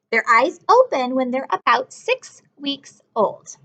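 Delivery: ordinary talk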